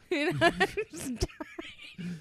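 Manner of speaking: ordinary talk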